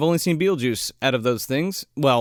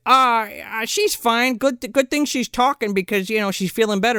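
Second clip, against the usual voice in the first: high, put-on wife voice; higher-pitched mock wife brushing it off; imitated wife; 8 semitones higher than usual. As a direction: play the dismissive wife up high. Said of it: "And she's like".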